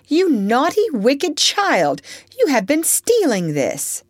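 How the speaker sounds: put-on loud, high scolding of a child. Aunt scolding the little thief, loud and sharp. Ophelia catches Topsy stealing.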